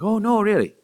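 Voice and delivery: high, loud mock Irish lilt; Irish accent. Picking up an Irish relative's cadence, Irish accent. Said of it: "talking to an Irish relative".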